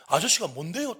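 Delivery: loud, high insolent challenge; defiant talking back. Retold or imitated. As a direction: throw the question in his face. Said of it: "제게 대들더라고요".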